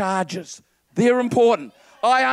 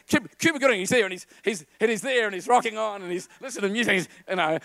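ordinary talk, nothing marked

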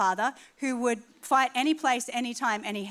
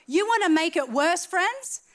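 preacher's challenge; 5 semitones higher, 3 dB louder than usual. Acted.

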